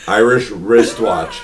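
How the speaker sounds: "Irish wristwatch" pronounced slowly, each sound spelled out; careful slow tongue twister. Shown as a demonstration. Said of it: "Say it, say it".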